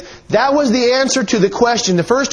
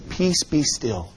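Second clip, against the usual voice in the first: hushed, low and gentle, much softer; meek soft reading; 5 semitones lower, 9 dB quieter, slower than usual. Shown as a demonstration. Preacher showing how not to read Christ's rebuke, meek and hushed. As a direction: read it far too softly and low, as a limp murmur. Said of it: "we can't read it like this".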